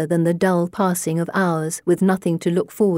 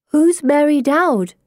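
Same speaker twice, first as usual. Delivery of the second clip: anxious question; 8 semitones higher, 4 dB louder, slower than usual. A narrator's reading. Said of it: "Anne's afraid she's missed something".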